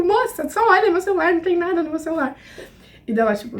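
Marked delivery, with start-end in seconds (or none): 0.0-2.3 exasperated pleading. Retold or imitated. Girl begging the customs officer to check her phone, exasperated pleading.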